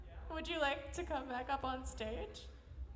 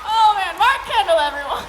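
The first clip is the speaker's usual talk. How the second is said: much higher, very loud cheer; hyped host; 6 semitones higher, 20 dB louder than usual. Real, not acted. Host bringing off the comic to the crowd, loud excited cheer. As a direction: roar the comic's name to the crowd.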